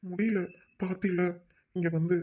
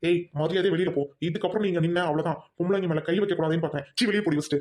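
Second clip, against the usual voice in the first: loud, furious order to get out; husband shouting his wife out of the room; angry yell; 4 dB louder than usual. Acted. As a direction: throw her out with fury.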